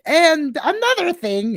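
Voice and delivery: playful self-mocking interjection; mock eager chime-in. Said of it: "and then I'm just like".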